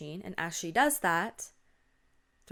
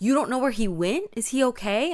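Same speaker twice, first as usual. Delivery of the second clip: loud, higher-pitched alarmed question; alarmed disbelief; 5 semitones higher, 9 dB louder than usual. Acted. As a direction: react in shock to a friend's news. Acted friend alarmed at news.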